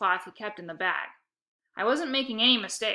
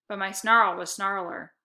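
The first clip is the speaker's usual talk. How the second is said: plain reading voice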